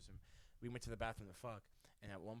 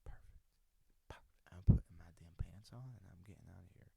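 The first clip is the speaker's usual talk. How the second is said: hushed whisper to himself; 4 semitones lower, 5 dB louder than usual. Retold or imitated. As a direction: whisper so she won't wake. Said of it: "and I said".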